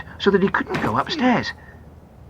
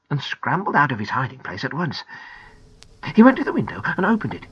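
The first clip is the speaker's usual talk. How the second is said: ordinary delivery, nothing marked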